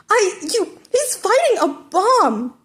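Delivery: exasperated protest. A narrator's reading. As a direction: sputter out the disbelief. Exasperated student protests to teacher.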